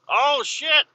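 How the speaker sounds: sudden high, loud yelp of dismay; alarmed exclamation. Real, not acted. Driver reacting to getting sucked into the snow bank, alarmed. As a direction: blurt it out, high and startled.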